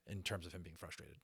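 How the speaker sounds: plain reading voice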